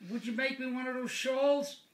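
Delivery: high-pitched parrot asking a favour; pleading parrot. Acted. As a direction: wheedle up high as a pet bird. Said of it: "And the bird says".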